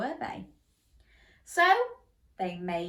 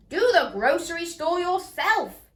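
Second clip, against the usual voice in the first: higher, louder rude snap; rude cry; 8 semitones higher, 6 dB louder than usual. A narrator's reading. Pig rudely refusing the hare, higher and louder. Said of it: "cried the pig rudely".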